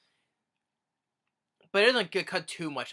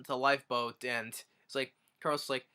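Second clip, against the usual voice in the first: usual speaking voice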